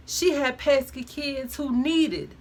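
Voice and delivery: usual speaking voice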